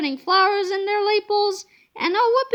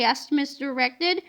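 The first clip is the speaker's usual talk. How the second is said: usual speaking voice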